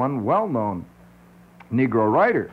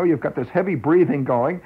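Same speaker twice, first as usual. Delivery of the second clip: usual speaking voice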